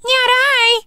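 tiny squeaking rodent refusal; a rat piping up high to refuse; squeaky rat. A narrator's reading. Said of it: "And the rat said".

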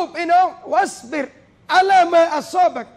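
preacher declaims the verse with force; proclaimed verse. Acted.

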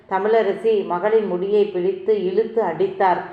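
ordinary delivery, nothing marked

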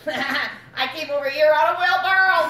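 loud, high, laughing punchline; cracking up at his own joke; laughing through it. Acted. Freddy tells his wheelbarrow joke, laughing loudly.

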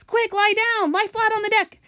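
high, urgent orders to take cover; urgent cry. A narrator's reading. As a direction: cry out to the others in danger. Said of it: "cried James".

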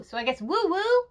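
usual speaking voice

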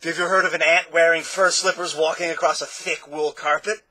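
loud, strange eager voice; a gleeful showman quizzing guests; Dr. Dissonance. Acted.

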